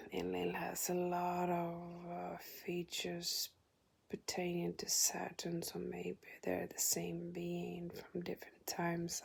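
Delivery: soft whisper, thinking aloud; whispered aside. Real, not acted. Narrator whispering her doubts about Enlil and Saturn. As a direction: murmur it to yourself, unsure.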